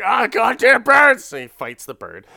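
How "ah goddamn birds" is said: much higher, much louder yell; angry shout. Retold or imitated. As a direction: yell at the bird landing beside him. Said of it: "He's just like".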